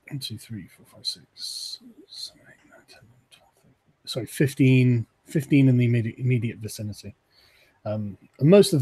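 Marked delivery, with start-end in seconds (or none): 0.1-3.7 counting under the breath, very soft; muttered counting. Real, not acted. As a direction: whisper the count to yourself.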